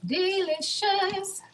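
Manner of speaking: high, slow, stretched-out DELICIOUS; drawn-out singsong. Pretend. Repeats the word playfully drawn out.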